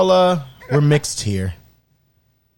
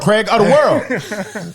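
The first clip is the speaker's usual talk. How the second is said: slow laughing emphasis; 5 semitones higher, much slower than usual. Acted.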